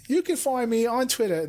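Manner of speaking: put-on a clumsy half-hearted try at Lita's accent; bad imitation of Lita's accent. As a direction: fumble through a bad copy of Lita's accent. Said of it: "That's such a bad accent".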